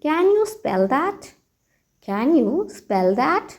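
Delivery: very slow, teacherly model phrase; slow model sentence. Shown as a demonstration. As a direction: spell it out slowly for learners. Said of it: "तो आप कहिए". (बहुत धीमे, सिखाने वाले अंदाज़ में)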